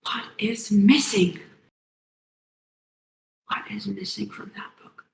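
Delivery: muttering. Acted. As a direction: mutter it under the breath, half whispered. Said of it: "hear him muttering again".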